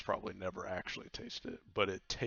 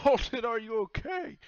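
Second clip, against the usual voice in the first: higher, louder concerned call; worried crewmate; 14 semitones higher, 9 dB louder than usual. Acted. Baku calls out to Alden after he vomits, high and worried. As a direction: call out in alarm for him. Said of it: "and Baku says".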